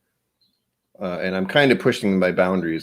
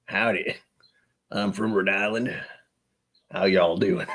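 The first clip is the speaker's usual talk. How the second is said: mock Southern accent; 4 dB quieter than usual. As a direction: play a jokey cowboy to match the mustache. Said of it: "Slipping into random accents is dangerous".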